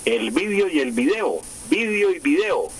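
"vídeo" as VÍ-de-o, then "video" as vi-DE-o; contrasting stresses. Shown as a demonstration. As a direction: set first-syllable "vídeo" against middle-stressed "video". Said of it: "utilizan las dos formas".

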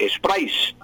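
"sprays" with a sharp final S; demonstrated "sprays", said "es-PRAIS". Shown as a demonstration. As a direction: let the S end "sprays" audibly. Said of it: "Así, con S".

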